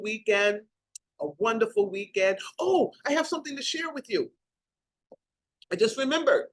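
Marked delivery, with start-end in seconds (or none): 2.6-6.5 sudden remembering. Real, not acted. Sudden recollection before signing off.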